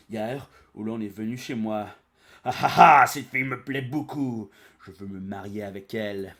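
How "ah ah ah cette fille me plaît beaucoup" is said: monster's gloating laugh. Retold or imitated. Monster Oolon voiced in retelling.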